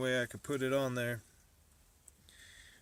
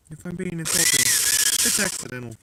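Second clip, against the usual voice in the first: louder, emphatic disclaimer; loud clarification; 3 semitones higher, 12 dB louder than usual. Acted. Maker warning viewers the accent is a quote, loud emphatic voice. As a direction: insist loudly it's only a quote.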